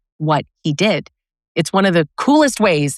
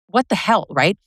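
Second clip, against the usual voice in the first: normal talking voice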